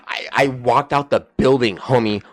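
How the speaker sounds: ordinary delivery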